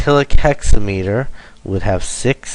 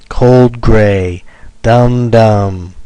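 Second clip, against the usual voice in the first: both "Cold" and "gray" loud, equally heavy; two even hammer beats, "dum dum"; spondaic stress; 9 dB louder, much slower than usual. Shown as a demonstration. Teacher demonstrating spondees, both syllables loudly stressed. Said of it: "which is two stressed".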